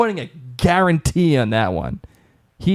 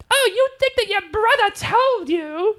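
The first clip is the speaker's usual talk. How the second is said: high, put-on British accent, mocking Claire. Host imitating Claire's British accent to the brother. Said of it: "I'm going to imitate Claire".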